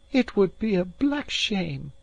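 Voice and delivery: high, slow distressed outburst; flushed with pity and shock; dismayed cry. A narrator's reading. The maid protesting the lad's fate, high and slow. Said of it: "she cried".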